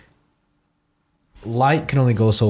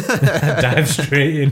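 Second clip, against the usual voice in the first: laughing; 4 semitones higher, much slower than usual. Real, not acted. Joking, laughing.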